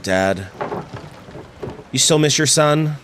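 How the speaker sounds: ordinary talk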